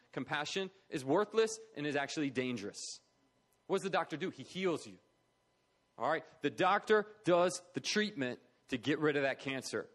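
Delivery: normal talking voice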